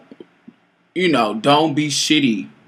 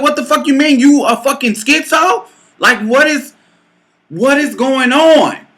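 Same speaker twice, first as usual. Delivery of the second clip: high, exasperated questioning; incredulous outrage; 8 semitones higher than usual. Retold or imitated. Woman challenging lover's excuse that it wasn't him, incredulous. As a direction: demand an explanation in disbelief. Retold quarrel, her disbelieving reply.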